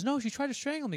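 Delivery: high, loud panicky protest; imitated frantic insistence. Insisting to a doubting husband about strangling, imitated high panic.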